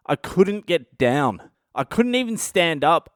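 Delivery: normal talking voice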